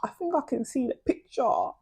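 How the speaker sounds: high, knowing realization; mock realization about her past; knowing hmm. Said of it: "I'm like".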